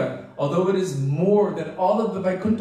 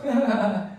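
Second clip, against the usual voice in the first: light laugh; 4 semitones higher than usual. Retold or imitated. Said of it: "he was just laughing with them also".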